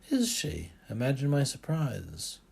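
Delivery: high, loud sarcastic jab; bitter sarcasm at the pushy father. Acted. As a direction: needle him with mock surprise. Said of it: "There's no need for that tone".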